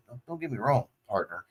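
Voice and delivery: Southern accent. Shown as a demonstration. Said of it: "I can do a really good Southern accent".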